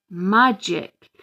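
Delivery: plain reading voice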